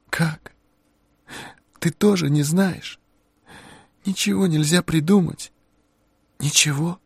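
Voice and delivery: lost, begging for an answer; desperate young woman. A narrator's reading. Harey pleading.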